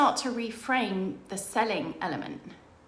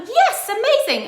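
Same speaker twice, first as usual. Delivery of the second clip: high, loud burst of delight; imitating an old school friend's excited yes; thrilled yes; 11 dB louder than usual. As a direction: leap at the offer. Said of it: "And she was like".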